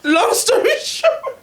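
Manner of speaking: high-pitched, giggly delivery; laughing. Real, not acted. Woman cutting a long story short for her co-hosts, laughing. Group cracking up over story.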